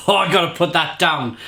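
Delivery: ordinary delivery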